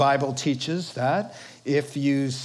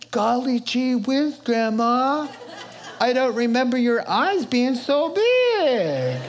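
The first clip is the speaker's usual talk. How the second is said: put-on high, eager little girl voice, a bit louder; gullible little girl; 8 semitones higher, 3 dB louder than usual. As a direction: play her wide-eyed and naive, pitched up. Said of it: "in walks little undiscerning, gullible".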